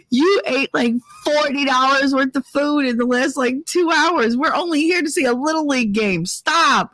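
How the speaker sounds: high-pitched, incredulous telling-off; exasperated scolding. Retold or imitated. Scolding friend for overeating at Little League, exasperated.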